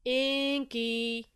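first call, held long on INKY. A narrator's reading. Friend calling for Inky outside his den, calling out. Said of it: "he heard a familiar voice call".

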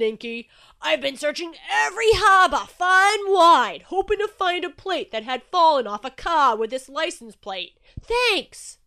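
very loud, elated character voice; thrilled booming Shark. A narrator's reading. Shark unwrapping a Massachusetts plate from Inky, thrilled booming.

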